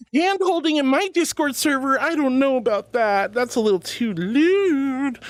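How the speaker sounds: clutch pearls at the idea of hand holding; mock scandalized. Streamer teasing chat about hand holding, mock scandalized.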